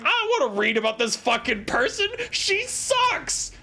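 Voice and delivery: exasperated, voice leaping very high at the end; fed-up outburst. Acted. Streamer refuses to read about her, fed-up outburst. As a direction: complain and build to a high squawk. Climax of rant about character.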